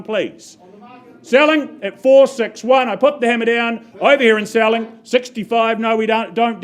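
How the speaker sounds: lively rhythmic auction calling; auctioneer calling bids. Real, not acted. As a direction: drive the bidding like an auctioneer.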